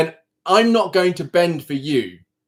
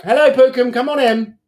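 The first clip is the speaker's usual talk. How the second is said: higher, sing-song hello to a pet; higher, cooing welcome to her pet; pet greeting; 8 semitones higher, 3 dB louder than usual. Real, not acted.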